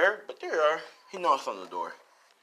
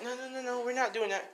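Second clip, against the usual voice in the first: high, softer embarrassed protest; flustered denial; 9 semitones higher, 5 dB quieter than usual. A narrator's reading. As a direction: deny it in a raised, flustered voice. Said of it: "she blushed".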